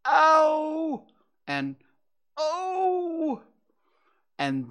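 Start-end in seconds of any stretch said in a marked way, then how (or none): 0.1-1.1 loud, very high "ow"; high-pitched wail of pain; pained yelp. A narrator's reading. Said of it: "Pooh only said". 2.4-3.4 very high, drawn-out "oh"; high strained wail; pained cry. A narrator's reading. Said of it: "Pooh only said".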